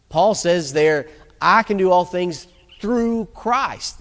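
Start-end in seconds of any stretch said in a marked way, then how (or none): 1.4-4.0 "through Christ" set apart, slow and weighty; slow down and land firmly on "through Christ"; stress on THROUGH CHRIST. Shown as a demonstration. Preacher showing where the verse's emphasis belongs, stress on THROUGH CHRIST. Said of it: "That's the emphasis".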